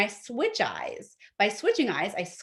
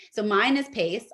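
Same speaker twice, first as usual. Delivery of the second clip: normal talking voice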